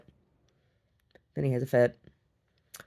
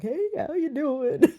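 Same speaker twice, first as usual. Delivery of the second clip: slow, much higher and louder, laughing; happy dog voice; 6 dB louder, slower than usual. Retold or imitated. Voicing the excited dog greeting her, high and slow with a laugh. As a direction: drawl it out like a beaming dog. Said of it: "He's like".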